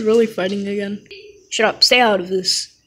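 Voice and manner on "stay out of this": usual speaking voice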